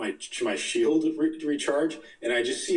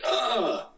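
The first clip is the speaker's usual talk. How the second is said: sudden high yelp of a hit guy; pained yelp; 13 semitones higher than usual. Retold or imitated. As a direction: mimic a shot enemy crying out. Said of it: "he was just like".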